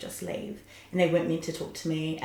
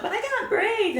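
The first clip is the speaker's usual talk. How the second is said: whiny protest; 4 dB louder, slower than usual. Retold or imitated. Her whining excuse to God.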